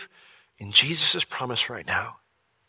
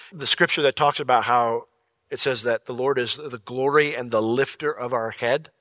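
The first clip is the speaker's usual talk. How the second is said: normal talking voice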